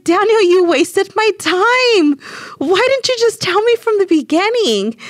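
high, loud exasperated telling-off; mad. Retold or imitated. Wife scolding husband who delayed her injection, angry and loud. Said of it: "And I was so mad".

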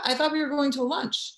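high, quick and bewildered; baffled protest. Retold or imitated. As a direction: sound caught completely off guard. Confused reply to brother.

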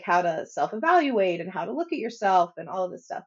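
normal talking voice

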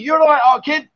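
put-on Birmingham accent, "You're right, our kid" pitched a little up with a rising end; Brummie accent. Shown as a demonstration. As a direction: lilt it like a Brummie. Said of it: "It's a Brummie".

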